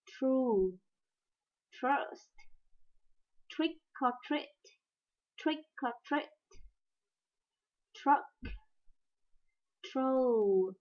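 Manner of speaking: tr in Tree, try, truck said crisply, each word spaced; English tr sound. Shown as a demonstration. Teacher demonstrates tr words for students. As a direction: model the tr cluster. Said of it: "Âm tr".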